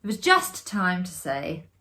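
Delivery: plain reading voice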